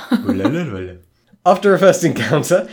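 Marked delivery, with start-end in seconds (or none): 0.1-1.5 laughing. Real, not acted. Repeats the name while laughing.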